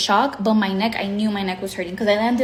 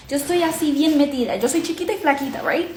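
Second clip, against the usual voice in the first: ordinary talk, nothing marked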